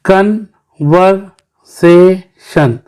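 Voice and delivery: Conversation slowly in four separate beats; split into syllables. Shown as a demonstration. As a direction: pause between each syllable of conversation. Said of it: "ये four syllable है".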